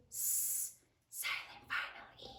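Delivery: soft unvoiced whisper. Shown as a demonstration. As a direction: breathe the words out quietly. Whispering letters while spelling.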